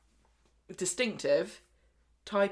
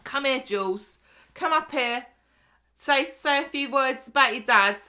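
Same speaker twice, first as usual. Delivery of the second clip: loud, higher, commanding widow voice; bossy shout; 6 semitones higher, 7 dB louder than usual. Retold or imitated. Widow ordering the mourners to listen, loud and bossy. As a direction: bark it at the room like a brash widow. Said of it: "Lou's like".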